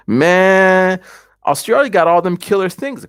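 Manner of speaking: long, loud, high "man"; exclamation. Real, not acted. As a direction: draw out a big exclaimed "man". Exclaiming about all the deadly creatures.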